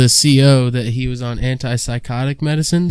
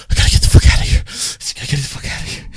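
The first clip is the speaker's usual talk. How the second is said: imitated paranoid, raspy loud whisper; frantic whisper; 3 dB louder, faster than usual. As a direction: whisper it hard, like a panicking cellmate. Panicking cellmate desperate to get out.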